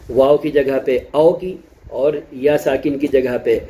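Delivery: ordinary delivery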